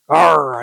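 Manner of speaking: loud playful pirate growl. Shown as a demonstration. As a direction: give a hearty pirate arr. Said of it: "I sound like a pirate now".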